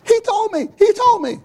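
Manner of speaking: high, loud imitated excitement; imitating mother, high and loud; overjoyed mother. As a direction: burst out with the news. Mother overjoyed running upstairs.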